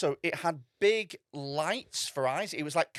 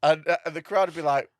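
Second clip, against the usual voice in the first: ordinary talk, nothing marked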